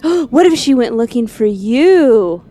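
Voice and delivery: breathless alarm, drawn out; gasping. Retold or imitated. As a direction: gasp then urgent, slow and loud. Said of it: "Then she was like".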